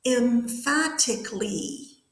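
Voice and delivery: "emphatically" drawn out slowly, each syllable clear; model pronunciation. Shown as a demonstration. Teacher models a harder, longer word for students, slow careful pronunciation. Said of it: "I'm gonna say the word".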